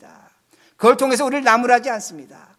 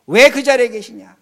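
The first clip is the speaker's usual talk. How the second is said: ordinary delivery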